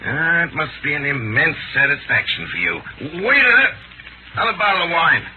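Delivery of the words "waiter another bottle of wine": calling out. Acted. Calling the waiter.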